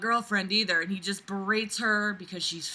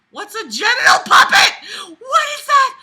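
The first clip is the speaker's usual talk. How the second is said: high, loud disbelieving yell; shocked shout; 13 semitones higher, 12 dB louder than usual. Real, not acted. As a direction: shriek it in outrage.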